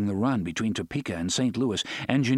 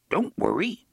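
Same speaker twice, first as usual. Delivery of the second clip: little gruff train voice, pitched high; gruff little talking train; small gruff voice; 8 semitones higher than usual. Acted. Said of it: "said a small, gruff voice".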